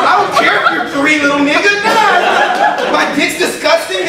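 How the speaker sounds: loud, high comic retort; indignant father. Acted. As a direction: argue back loudly at a small child. Father arguing back at toddler.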